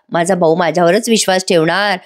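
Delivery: ordinary delivery, nothing marked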